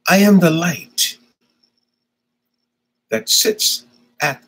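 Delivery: slow, declaimed poem with long pauses; solemnly spaced spoken-word delivery; poem recitation. Acted. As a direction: recite it phrase by phrase, letting each one hang. Recites his poem for Brian.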